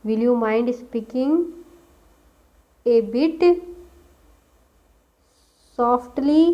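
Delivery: slow, each word spaced out; slow dictation. Shown as a demonstration. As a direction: dictate it slowly. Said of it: "देखिए किस तरह से वाक्य का use".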